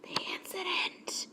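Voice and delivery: the incident, whispered; ominous whisper. Real, not acted. Vlogger whispers the incident ominously to viewers. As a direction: whisper it darkly. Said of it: "whispered ominous repeat".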